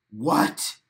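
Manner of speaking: low, startled disbelief; a jolted what?; shocked what. Real, not acted. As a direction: caught off guard by a comment. Shocked by viewer comment.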